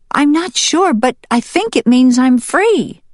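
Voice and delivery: high little hen voice; high-pitched, earnest hen; hopeful hen. Acted. Hen explains to mallard.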